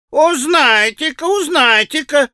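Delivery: very high, drawn-out taunt; mocking boys' jeer. A narrator's reading. Boys laughing at Alyosha's plan, mocking jeer. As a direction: tease in a high sing-song schoolboy voice. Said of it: "засмеялись мальчики".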